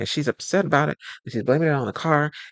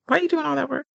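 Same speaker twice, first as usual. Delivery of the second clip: high incredulous question; 13 semitones higher, faster than usual. Acted. Mocking overeager fixer.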